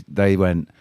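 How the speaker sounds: normal talking voice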